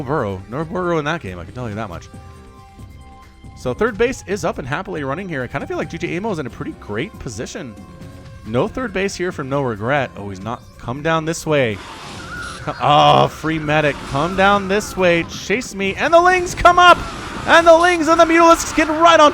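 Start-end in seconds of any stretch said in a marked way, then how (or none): none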